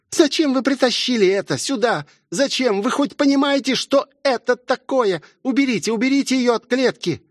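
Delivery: высоко, испуганно, почти срываясь на крик; в панике умоляет убрать девушку от клетки; в ужасе. A narrator's reading. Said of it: "с ужасом забилась в угол". (Элизабет в ужасе кричит охранникам, сдавленный испуганный крик)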